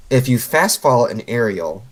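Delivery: slow, careful explanation; deliberately slow. Shown as a demonstration. Coach explains a confusing point to student, slowly. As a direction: say it slowly so nobody trips over it. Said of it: "I'm gonna say this slowly".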